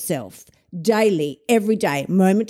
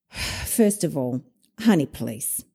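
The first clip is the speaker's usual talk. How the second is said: dismissive; 7 semitones lower, 5 dB quieter, slower than usual. Real, not acted. Dismissive retort to the girlfriend.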